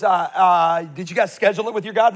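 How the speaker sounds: teasing, mock-innocent question; mocking taunt. Preacher voicing Elijah mocking the prophets of Baal, sly and teasing. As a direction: needle them like a smug prophet. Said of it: "He taunts them".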